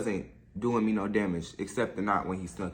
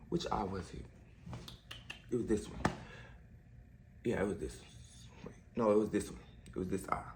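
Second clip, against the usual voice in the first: muttering to self; 3 semitones lower, 8 dB quieter than usual. Real, not acted. Checking which eye, muttering.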